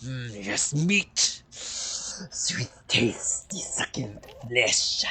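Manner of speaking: ravenous wolf, slipping into a whisper; whispery, gloating talking wolf. Acted. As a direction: savor each word like a hungry beast. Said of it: "this voice for the wolves".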